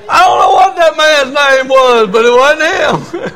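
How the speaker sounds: loud amused outburst; loud punchline. Real, not acted. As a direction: belt out the punchline. Punchline of the mix-up.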